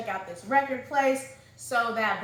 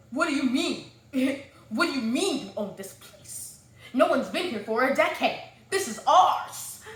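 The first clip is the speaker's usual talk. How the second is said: hostile retort; 3 semitones higher, 5 dB louder than usual. Retold or imitated. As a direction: play him as mildly hostile and a little amused. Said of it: "saying it out loud that hostilely".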